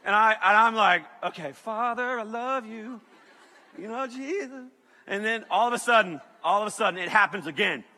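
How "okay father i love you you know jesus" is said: soft, strained little plea; timid panicked prayer. Retold or imitated. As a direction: pray meekly in mock terror. Acts a frightened prayer.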